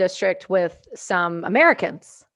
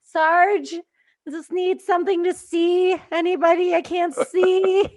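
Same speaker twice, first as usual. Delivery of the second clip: much higher, slow whining voice; mock whiny plea; 9 semitones higher, 3 dB louder, slower than usual. Gunner begging the sergeant for night vision, mock whine. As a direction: beg the sergeant pitifully. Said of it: "I'm like".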